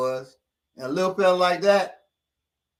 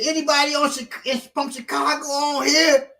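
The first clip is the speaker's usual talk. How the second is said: 1 ranting passenger; 11 semitones higher than usual. Retold or imitated. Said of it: "So he said".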